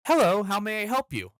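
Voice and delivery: bright, high, polite front-desk greeting; chirpy service voice; customer service voice. Retold or imitated. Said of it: "I asked in my customer service voice".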